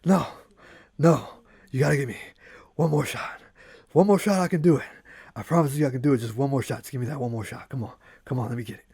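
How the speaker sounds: acted breathless begging in short bursts; panting, out of breath. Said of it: "super just panting out of breath again, like".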